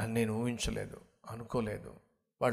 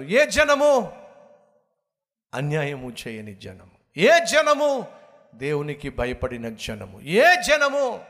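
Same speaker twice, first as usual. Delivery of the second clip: preaching shout; 2 semitones higher, 8 dB louder, slower than usual. Real, not acted. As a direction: fire the question at the crowd. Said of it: "preacher's rhetorical call". (ప్రసంగంలో గట్టి ప్రశ్న)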